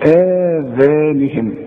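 آذانهم said very slowly with a long آ; natural madd. Shown as a demonstration. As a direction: stretch آذانهم evenly. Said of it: "المد الطبيعي وتقول".

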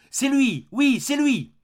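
hurlé bien plus haut et plus fort; un cri de reconnaissance arraché au supplicié. A narrator's reading. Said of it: "il cria". (le prisonnier crie en reconnaissant Gwynplaine)

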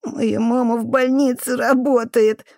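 high, slow tearful whine; crying boy. A narrator's reading. Boy answering the janitor's insult about his mother, crying. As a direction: defend mother through tears. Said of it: "плакал пацан".

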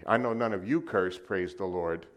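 ordinary talk

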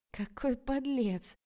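a playful tittering scold; giggling. A narrator's reading. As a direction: giggle through the words. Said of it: "хихикнула Рая".

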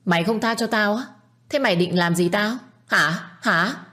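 high-pitched goading questions; defiant challenge. A narrator's reading. Aunt dares the young man to act, defiant challenge. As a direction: throw the threat back at him.

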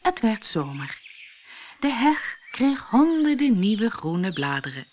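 ordinary delivery

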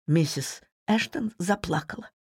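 usual speaking voice

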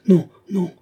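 high, loud, abrupt refusal; brief, jerky voice. A narrator's reading. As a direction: cut in with short jerky bursts. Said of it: "d'une voix brève et saccadée". (voix brève et saccadée, haute et forte)

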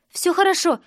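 high, urgent reassurance; strained reassurance. Acted. Sister holding the door shut from brother, strained. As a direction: call back that all is fine, strained.

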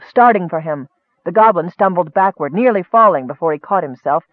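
ordinary delivery

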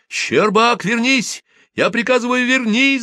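very high-pitched urgent yell; shouted order. A narrator's reading. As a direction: call him back as his commanding officer. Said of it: "закричал Саня". (отчаянный командный крик, очень высоко)

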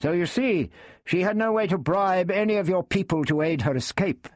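high, reasoning knight. A narrator's reading. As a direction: make the case as the knight. Knight voiced.

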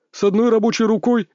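high, smirking retort. Acted. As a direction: scoff at him with a smirk. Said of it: "усмехнулся один из медиков".

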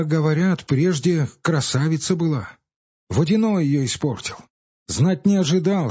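lightly voiced village boy; a touch of a grave boy; boy's voice. A narrator's reading. Boys discuss Akulina.

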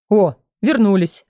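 mildly surprised exclamation; a light note of surprise at their return. A narrator's reading. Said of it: "удивлённый голос Лага".

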